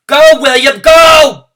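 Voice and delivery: very high, loud urgent shout. Acted. Junie B shouting at frozen William to go, very loud. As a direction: shout at him to run. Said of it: "I shouted".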